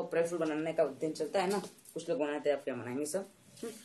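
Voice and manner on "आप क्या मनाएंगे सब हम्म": ordinary delivery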